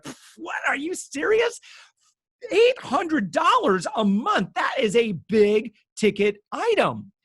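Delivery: high, loud, hammed-up incredulous shout; shocked disbelief. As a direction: replay your own jaw-drop reaction at full volume. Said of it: "I'd be like".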